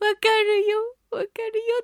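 high, weepy commiseration; hammed-up crying-with-pity voice; mock tearful sympathy. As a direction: coo it through pretend sobs. Acts out tearful sympathy for sufferer.

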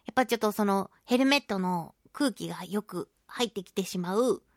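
normal talking voice

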